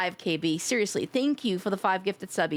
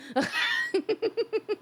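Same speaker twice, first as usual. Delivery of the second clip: high giggle; 10 semitones higher than usual. Real, not acted. Laughing after joke.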